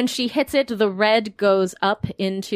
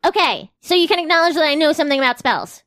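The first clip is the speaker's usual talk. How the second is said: character voice; 6 semitones higher, 5 dB louder, faster than usual. Acted. Asteria voiced to Monique.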